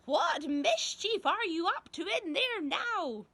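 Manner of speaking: put-on high, loud, slow old-woman screech; shrill accusing grandma voice; screeching granny. A narrator's reading. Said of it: "Granny screeched".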